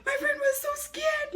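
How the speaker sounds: very high, strained squeak; thin, squeaky exclamation; high squeal. Real, not acted. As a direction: squeal it out at the top of the range. Squealed comment on friend's terror.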